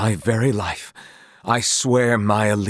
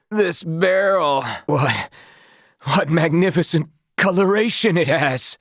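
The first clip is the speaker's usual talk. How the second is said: spellbound; 8 semitones higher than usual. Acted. Bewitched knight admiring a barrel, high and awed. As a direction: marvel at the barrel like a lunatic. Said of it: "Was I under some spell?".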